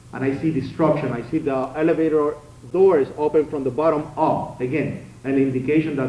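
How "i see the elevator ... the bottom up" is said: ordinary talk, nothing marked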